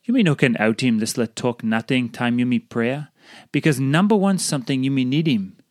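usual speaking voice

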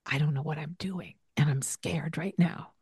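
scared whisper. Retold or imitated. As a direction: whisper it like a frightened admission. Parent's hidden fear.

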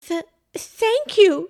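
high, slow, stammered thanks; flustered stutter. A narrator's reading. Pam thanks the man who caught her, flustered stutter. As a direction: trip on the first sound, flustered. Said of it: "she stuttered, both cold and so flustered".

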